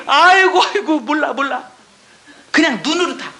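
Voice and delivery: normal talking voice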